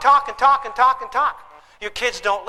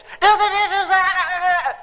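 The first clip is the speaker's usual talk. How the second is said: caged dad screaming; 8 semitones higher than usual. Retold or imitated. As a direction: scream it from inside a cage. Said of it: "screaming, rattling the cages".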